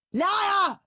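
high, loud accusation; accusing yell. Acted. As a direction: fling it at your brother. Brothers quarreling.

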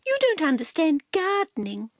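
high, quick superior announcement; Cat announcing. A narrator's reading. Cat announces to Dog, high and quick. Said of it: "announced Cat".

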